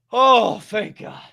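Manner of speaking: slow, drawn-out sigh of relief; slow relieved thank God. Retold or imitated. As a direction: let the weight fall off. Relief after debt forgiven.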